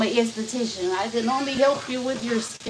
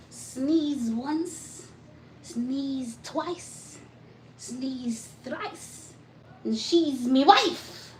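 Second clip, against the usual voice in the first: a light playful Irish leprechaun lilt; mock Irish rhyme, half-sung and lilting; 3 semitones higher, much slower than usual. Teasing chat viewers in a light mock Irish leprechaun lilt. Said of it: "It was that leprechaun voice".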